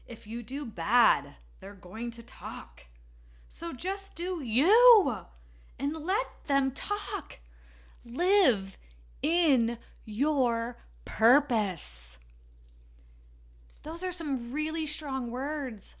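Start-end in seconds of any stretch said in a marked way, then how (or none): 3.5-12.2 slow, higher, drawn-out exhortation; motivational preaching. Acted. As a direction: deliver it like a rousing sermon.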